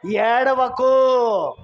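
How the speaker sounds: hugely drawn-out preacher's cry; elder's exhortation. Retold or imitated. Preacher voicing elder to weeping John, drawn-out exhortation. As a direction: stretch it out slowly as an elder's command. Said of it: "పెద్దలలో ఒకడు ఏమన్నాడు?".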